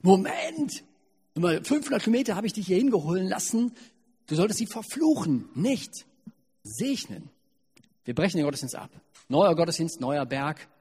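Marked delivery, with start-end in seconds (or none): 0.0-7.3 much higher, louder, fast indignant outburst; the king can't believe his ears; appalled outrage. Acted. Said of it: "Balak ist total entsetzt und sagt". (entsetzt und empört, hoch und laut)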